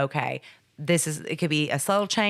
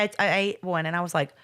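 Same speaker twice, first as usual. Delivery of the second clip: normal talking voice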